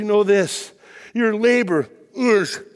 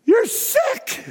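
mock shocked; 12 semitones higher than usual. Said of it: "We're like".

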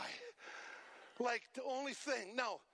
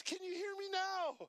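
teasing question; 9 semitones higher than usual. Retold or imitated. Someone testing the healed deaf man, playful high question. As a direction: test the newly healed man. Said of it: "somebody says".

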